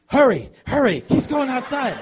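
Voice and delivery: high, loud urgent call to the staff; urgent shout. Retold or imitated. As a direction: hurry them, loud and high. Gina urgently calling staff.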